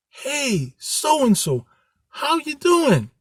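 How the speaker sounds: high, upbeat imitated phone greeting; cheery greeting. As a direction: greet the caller like nothing's wrong.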